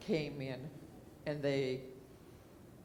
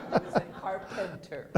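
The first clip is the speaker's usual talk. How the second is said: laughing; 5 semitones higher, 6 dB louder than usual. Real, not acted. Repeating the punchline to a friend while laughing. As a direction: laugh through the words, pitched high.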